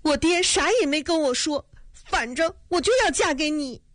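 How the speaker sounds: a lightly voiced weepy girl; crying. A narrator's reading. Girl tearfully insisting to her fiancé. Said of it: "哭着说".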